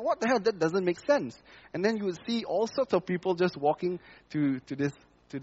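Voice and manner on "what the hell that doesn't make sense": much higher, indignant cry; a retold protest pitched way up; upset outburst. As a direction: blurt it out in disbelief. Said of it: "so I was upset".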